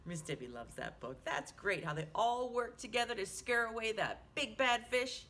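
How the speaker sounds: ordinary delivery, nothing marked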